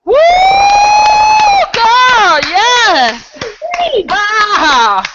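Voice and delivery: high, loud whoops of delight; excited cheering. Real, not acted. Host hyping up Precious after her speech, loud excited cheers. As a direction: cheer her on at full volume.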